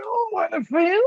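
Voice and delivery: very high, strained mock singing; straining on toilet. Co-host mocking the singer, comic high toilet strain. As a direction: squeeze it out like straining on the loo. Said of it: "it sounded more like he was doing a poo".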